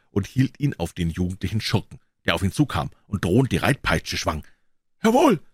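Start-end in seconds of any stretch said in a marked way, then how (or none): 5.0-5.4 loud, high exclamation; defiant Carruthers. Acted. Carruthers unmasks himself before the villain, loud and high. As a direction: bark it out, much higher.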